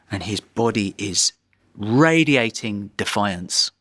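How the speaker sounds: normal talking voice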